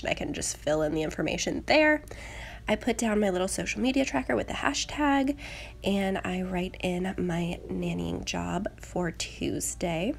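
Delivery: usual speaking voice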